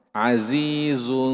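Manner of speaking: Azizun slow with rounded lips on zu. Shown as a demonstration. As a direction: stretch Azizun with pouted lips. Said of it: "posisi bibir kita tetap monyong". (azizun bibir monyong)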